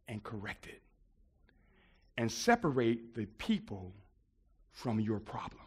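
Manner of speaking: hushed, low, with long weighty pauses; solemn mentor's advice. Retold or imitated. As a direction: impart the lesson softly, phrase by phrase.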